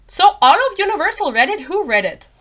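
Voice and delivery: loud, high-pitched disbelief; incredulous. Real, not acted. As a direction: challenge the claim, pushing pitch and volume up. Challenges the author's claim.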